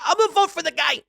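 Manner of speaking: high mocking impression; imitating Michael Rapaport. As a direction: do his voice, high and pushy. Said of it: "And now he's like".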